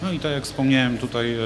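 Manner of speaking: ordinary talk, nothing marked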